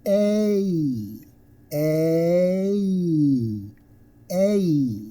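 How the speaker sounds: long "A" gliding from eh to ee; two-sound diphthong. Shown as a demonstration. Teacher asks learners to listen, "A" stretched. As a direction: hold "A" and slide through both sounds.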